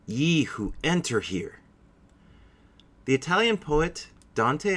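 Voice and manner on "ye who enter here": dramatic reading. Acted. Dramatic opening quote from Dante.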